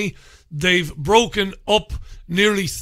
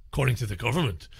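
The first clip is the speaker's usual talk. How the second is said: lower, softer, quicker repeat; quiet aside; 6 semitones lower, 7 dB quieter, much faster than usual. Real, not acted. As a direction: drop it into a quick, low aside. Muttered repeat as a sceptical aside.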